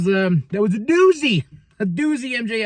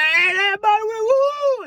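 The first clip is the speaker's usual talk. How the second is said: much higher hammed-up cheering; Jeff Jarrett. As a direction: whoop like an over-excited hype man. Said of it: "Jeff Jarrett, like".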